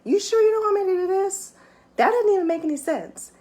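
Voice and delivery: high, quick questioning of God; incredulous questioning. Retold or imitated. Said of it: "I'm questioning".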